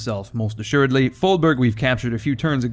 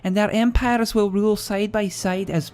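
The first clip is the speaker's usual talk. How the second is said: higher Scottish woman's voice; 8 semitones higher than usual. Retold or imitated. Gamer reading the quest giver's regicide plea in Scottish. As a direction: read it as a scheming Scottish princess. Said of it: "apparently it's a Scottish woman".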